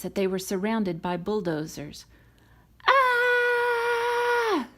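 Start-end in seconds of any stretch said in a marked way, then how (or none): 2.9-4.7 high loud cry of fright; sudden high panicked scream. Acted. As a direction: shriek as you race away. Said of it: "screamed Lightning".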